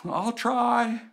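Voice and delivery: high-pitched, noncommittal mock answer; irresponsible. Groom answering the preacher at the altar, high and noncommittal. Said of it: "irresponsible voice".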